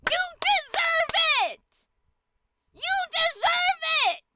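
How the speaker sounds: very high, very loud scream, slow. Acted. Jackie yelling at the friend, high screamed fury. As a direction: scream it high like you've snapped. Voicing furious chat reply.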